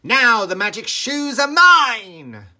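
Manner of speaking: very loud, high, slow gloat; gloating witch. A narrator's reading. Wicked Witch claiming the shoes from Dorothy, high, loud and slow. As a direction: crow over the prize like a villain. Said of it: "cried the Wicked Witch".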